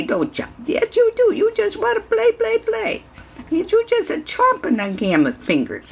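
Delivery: very high coo at a puppy. Real, not acted. Sustained baby talk.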